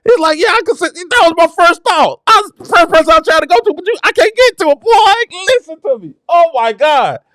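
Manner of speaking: very high, ecstatic cries; real rapture, voice way up high; carried away with joy while preaching; happy, overcome. Real, not acted. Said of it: "I'm sorry when I get happy like that".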